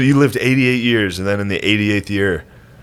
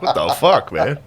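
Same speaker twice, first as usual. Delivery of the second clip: pitched-up disbelief through laughter; laughing retort; 2 semitones higher than usual. Retold or imitated. A nephew pushing back at his uncle, laughing. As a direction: push back, half laughing.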